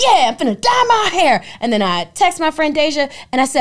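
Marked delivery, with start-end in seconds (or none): 0.0-1.4 excited. Retold or imitated. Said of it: "I'm like".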